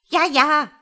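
high, loud cackling interjection; chattering magpie. Acted. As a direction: butt in with a shrill-free squawk of agreement. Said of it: "schnatterte die Elster dazwischen".

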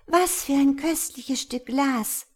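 high, delighted exclamation; delighted little girl. Acted. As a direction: exclaim it like a thrilled child. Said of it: "rief das kleine Mädchen".

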